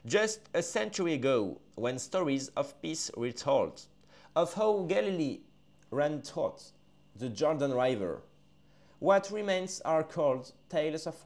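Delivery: put-on slow, solemn English verse read in a French accent; slow solemn recital of English lyrics; poem recital. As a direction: intone each line slowly, leaving pauses. Reads English poem aloud.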